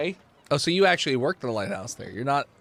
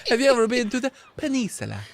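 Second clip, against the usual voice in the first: high silly voice; 10 semitones higher, 3 dB louder than usual. Acted.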